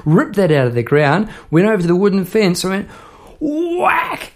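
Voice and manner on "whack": very high, drawn-out whack; imitated whack. Hitting the neighbour's fence, high yelled impact noise. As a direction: cry out the whack like a sound effect.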